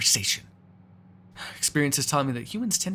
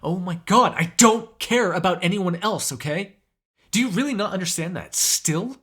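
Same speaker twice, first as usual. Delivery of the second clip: raised, emphatic angry tirade; heated, higher-pitched rant; furious outburst; 5 semitones higher, 5 dB louder than usual. Acted. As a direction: hammer each point home, loud and exasperated.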